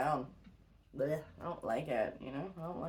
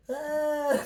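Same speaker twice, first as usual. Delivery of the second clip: a short, half-hearted yell that won't go high; weak mock scream; 11 semitones higher, 9 dB louder than usual. Shown as a demonstration. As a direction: try to scream but it falls short. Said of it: "I can't ever get that high, though, like, screaming".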